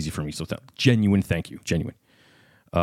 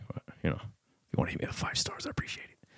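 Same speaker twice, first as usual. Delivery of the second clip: near-whispered, much softer plea; hushed aside; 3 semitones lower, 14 dB quieter than usual. Real, not acted. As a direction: drop to a whisper, sheepishly asking. Sheepish aside asking for ratings.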